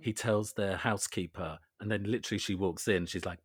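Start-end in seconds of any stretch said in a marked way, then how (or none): none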